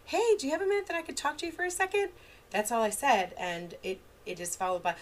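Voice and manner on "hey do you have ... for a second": high, light, fast sing-song ask; gentle parent request. Retold or imitated. Parent gently asking child for a minute to talk. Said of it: "And I, and I just said".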